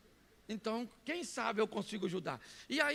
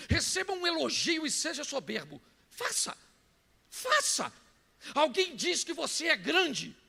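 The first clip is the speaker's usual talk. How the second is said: high, loud sermon delivery; forceful preaching; 7 semitones higher, 6 dB louder than usual. Real, not acted. Preacher daring the congregation to act proud, forceful preaching. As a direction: push the challenge out with rising force.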